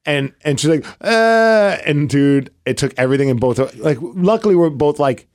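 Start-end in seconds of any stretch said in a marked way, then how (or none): 1.0-1.8 higher, drawn-out whine; imitated child's cry. Dad retelling his daughter's wasabi shock, mock crying whine. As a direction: mimic a little girl's wail. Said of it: "just starts crying".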